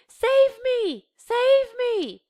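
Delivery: very high, loud desperate screams; screaming in terror. Acted. Anne screaming in her sleep, very high and loud. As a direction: scream for rescue from the nightmare. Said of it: "She screams and screams".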